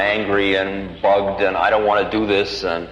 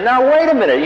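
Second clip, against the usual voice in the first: alarmed protest; 13 semitones higher, 4 dB louder than usual. Retold or imitated. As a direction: voice jumps up high in sudden alarm. Protesting the car being given.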